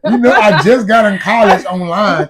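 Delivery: high, loud, laughing tease. Real, not acted. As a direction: talk through laughter. Teasing banter.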